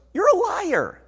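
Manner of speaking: ordinary talk, nothing marked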